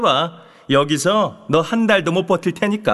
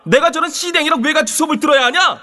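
angry; 9 semitones higher than usual. Acted. Bully sneers at transfer student.

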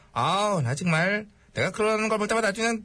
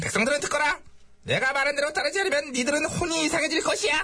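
higher-pitched, imperious proclamation; royal decree; 4 semitones higher than usual. Acted. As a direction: address your subjects as a threatening monarch. Ruler proclaims to subjects.

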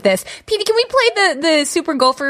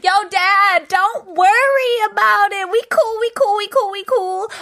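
tinggi, santai, gaya anak gaul; laid-back son; 7 semitones higher than usual. Acted. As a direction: jawab ayah dengan cuek dan santai. Memerankan anak yang santai.

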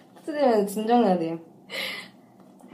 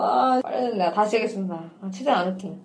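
ordinary talk

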